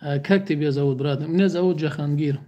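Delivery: ordinary delivery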